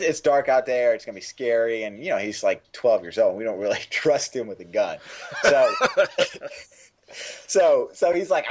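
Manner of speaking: ordinary talk, nothing marked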